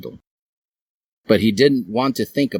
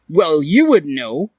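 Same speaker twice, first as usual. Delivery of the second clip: high character voice; 9 semitones higher, 6 dB louder than usual. A narrator's reading. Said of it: "said Hiei".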